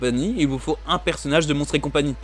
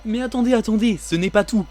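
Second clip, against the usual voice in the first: much higher, TV-salesman hype; infomercial; 7 semitones higher than usual. Pretend. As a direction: sell it like a late-night ad. But wait, there's more.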